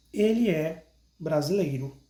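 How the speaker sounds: ordinary talk, nothing marked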